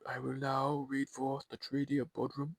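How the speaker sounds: slow, odd-sounding stately reading; unusual emperor voice. Shown as a demonstration. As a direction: read it slowly in a strange new voice. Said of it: "This is not my normal Emperor voice".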